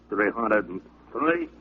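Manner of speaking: slow, effortful counting between reps; straining mid push-up. Acted. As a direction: count out each push-up slowly. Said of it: "Seymour did his push-ups".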